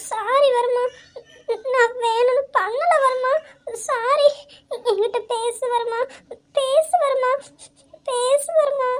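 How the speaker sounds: desperate tearful begging; sobbing plea. Acted. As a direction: break down while begging him to speak. Wife begging silent husband, crying.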